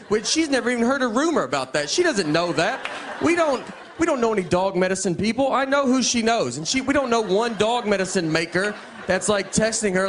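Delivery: high-pitched, worked-up disbelief; incredulous comic rant. Acted. Husband ranting about his wife's dog medicine claim, mock incredulity.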